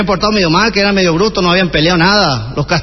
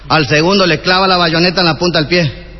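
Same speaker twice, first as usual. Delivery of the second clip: usual speaking voice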